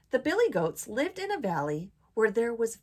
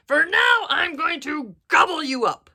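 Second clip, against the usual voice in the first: loud, menacing storybook bellow; troll voice; 6 semitones higher, 11 dB louder than usual. A narrator's reading. Troll threatening to eat the goat, loud bellow. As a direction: threaten at full volume. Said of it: "said the troll".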